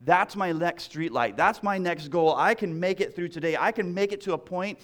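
preaching that swells toward joy; rising sermon close. Acted. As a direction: build to a rejoicing finish. Closing preaching build.

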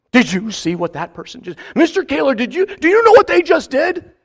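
a hammed-up whiny tattler; whiny complainer. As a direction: play the offended tattletale. Mimics a complaining offended person.

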